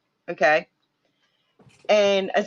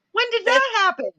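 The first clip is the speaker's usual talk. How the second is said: much higher, disbelieving question; astonished; 14 semitones higher, faster than usual. Retold or imitated. Mother telling a friend about the new store, high disbelief. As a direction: sound floored that it appeared. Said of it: "And I'm like".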